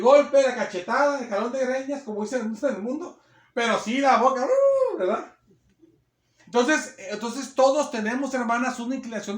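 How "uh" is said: high squealed uh; a sharp yelp of mock shock; mock exclamation. Mocking a sharp tongue. (exclamación aguda burlona)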